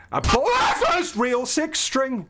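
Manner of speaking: high shriek of fright mid-sentence; startled scream. Real, not acted. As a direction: scream through the words at a jump scare. Jump scare during horror game.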